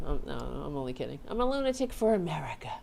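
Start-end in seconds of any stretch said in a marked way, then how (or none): 1.3-2.7 high, rushed, hammed-up proclamation; playful overblown rallying cry; mock-patriotic cry. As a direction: cry it out like a zealot, jokingly. Joking patriotic lunatic proclamation.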